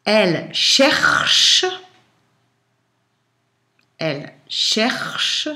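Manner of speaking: slow, word-by-word dictation; dictating. Shown as a demonstration. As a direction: dictate slowly with long pauses.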